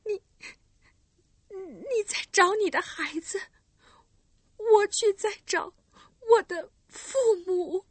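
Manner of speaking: high, halting, near crying; stammering tearful reply. Acted. Girl answers the recluse, stammering and tearful. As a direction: stumble over the words, tearful. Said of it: "结结巴巴地说".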